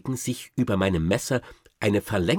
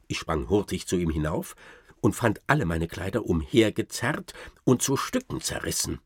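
plain reading voice

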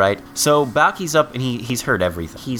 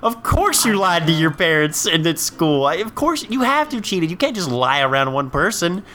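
incredulous; 8 semitones higher than usual. Real, not acted. Incredulous rant at Larry's claim.

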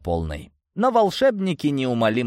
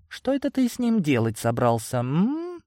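higher, teasing questioning voice; coy femme fatale; 9 semitones higher than usual. A narrator's reading. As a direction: probe sweetly, ending on a high hum. Femme fatale coyly questioning hero. (игриво, высоким голосом роковой красотки)

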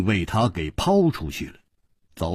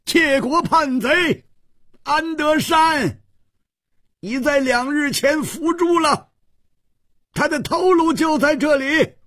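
大喝宣告. A narrator's reading. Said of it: "邱次王大喝道". (高声大喝，一字一顿地宣告)

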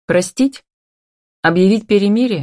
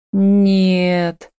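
long drawn-out, pitiful no; understated, stretched-out whimper; plaintive whine. A narrator's reading. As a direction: whine it mildly, holding the vowel. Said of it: "проскулила она жалостливо".

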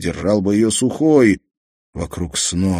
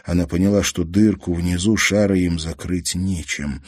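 ordinary delivery

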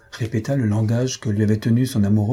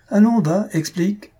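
high, loud goading; impatient demand; 10 semitones higher, 4 dB louder than usual. Acted. Husband orders the girl to explain herself, impatient. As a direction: push her to get on with it, pitched up.

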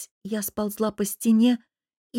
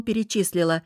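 ordinary delivery

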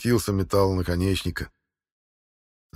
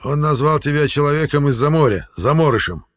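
explaining; 6 semitones higher, 5 dB louder than usual. A narrator's reading. Eril explains the insult.

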